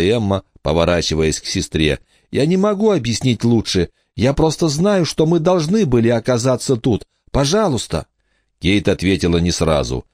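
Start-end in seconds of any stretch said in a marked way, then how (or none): 2.3-8.1 put-on high, earnest child's plea; high-pitched girlish pleading; pleading little girl. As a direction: beg an older sister to trust you. Emma pleads with her sister.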